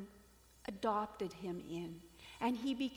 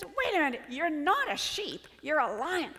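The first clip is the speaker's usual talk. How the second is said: loud, higher-pitched protest; insistent lion; 5 semitones higher, 9 dB louder than usual. Acted. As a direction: set the foolish sheep straight. Lion corrects the sheep lion.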